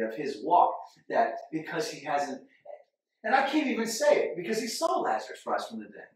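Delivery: ordinary delivery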